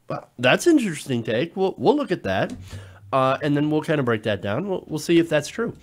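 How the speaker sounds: normal talking voice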